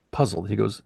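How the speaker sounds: ordinary talk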